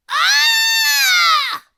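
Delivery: very high, loud yelp; startled yelp. Acted. Splat jumping high in fright, a very high loud yelp. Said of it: "Splat yelped".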